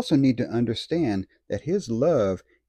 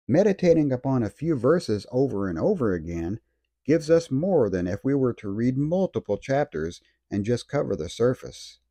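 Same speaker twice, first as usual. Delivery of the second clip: ordinary delivery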